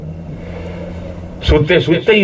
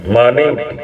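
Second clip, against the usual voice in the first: ordinary delivery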